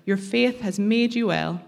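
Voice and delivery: ordinary delivery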